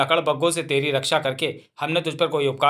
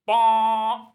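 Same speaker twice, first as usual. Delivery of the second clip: elephant trumpet; 6 semitones higher, much slower than usual. Acted.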